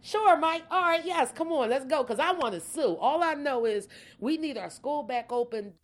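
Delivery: high-pitched, eager rush to say yes; eager agreement. Retold or imitated. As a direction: pitch jumps up, raring to go. Acts out her own eager reply.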